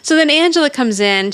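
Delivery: ordinary delivery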